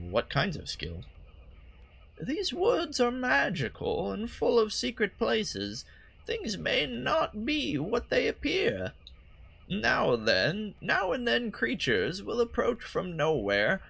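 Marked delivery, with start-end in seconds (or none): 2.2-13.8 put-on old lady voice. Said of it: "old lady voice for her".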